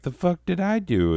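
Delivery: high, put-upon squeak of offended innocence; love, indignant. Acted. Love personified answering the lonely heart, high indignant protest. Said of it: "And love's like".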